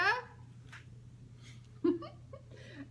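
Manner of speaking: soft breathy chuckle at the joke; quiet delighted laugh; amused laugh. Real, not acted. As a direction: laugh at the silly picture. Laughs at riddle answer.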